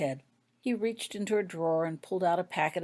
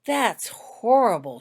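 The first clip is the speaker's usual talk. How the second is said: slow, louder heartfelt dismay; with feeling; 4 semitones higher, 7 dB louder, slower than usual. A narrator's reading. Holly, shocked by the curse's victims, speaking with feeling. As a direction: let the horror sink in slowly. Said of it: "she said with feeling".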